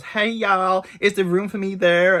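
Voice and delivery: high, loud American tourist voice; mock American accent. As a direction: imitate a pushy American lady. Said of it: "this American comes up and goes".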